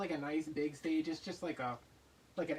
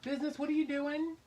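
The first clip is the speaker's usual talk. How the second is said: high and louder, sing-song pet voice; calling the pet; 10 semitones higher, 5 dB louder than usual. Real, not acted. Owner calling her pet Business, high and louder. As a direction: call out to the pet as he gets into something.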